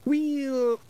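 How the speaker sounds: high, drawn-out Scots hedging; shifty. A narrator's reading. Pictsie chief admitting he was drinking, shifty. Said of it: "Rob looked a little shifty".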